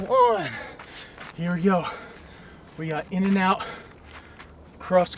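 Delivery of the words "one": high shouted final one; shouted count. Real, not acted. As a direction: yell the last count.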